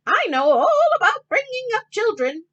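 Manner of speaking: high, loud confident mother duck; proud and high as the mother duck; Mrs. Mallard. Acted. Mrs. Mallard boasts to her husband, high and loud. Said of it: "said Missus Mallard".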